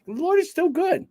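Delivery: high, stretched "Lord" as a happy reminder; emphatic reminder. Shown as a demonstration. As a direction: sing out "Lord" up high, then "still good". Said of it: "shove that right in there and remind you".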